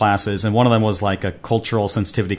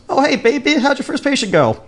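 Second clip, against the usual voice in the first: much higher-pitched sing-out greeting; imitated friendly nurse. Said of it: "the nurse turns to me and goes".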